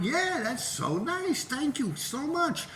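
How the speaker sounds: high, slow, overly grateful voice; gushing thanks. Retold or imitated. Thanking the guy for the video, high and gushing. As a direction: gush your thanks, way up high. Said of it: "I'm like".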